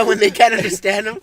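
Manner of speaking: ordinary delivery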